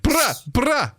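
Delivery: a weak Jamaican accent impression; bad Jamaican accent. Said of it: "trying to do bad Jamaican accents".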